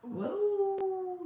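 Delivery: whoa jumps way up high; a sudden high gasp of wonder; startled whoa. Real, not acted. Medium hit by a vision, crying out whoa in surprise.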